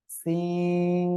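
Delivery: সিন held very long, Quranic style; drawn-out letter seen. Shown as a demonstration. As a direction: stretch সিন long on one steady note. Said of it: "এই অক্ষরের নামটা কি?". (সিন দীর্ঘ টেনে উচ্চারণ)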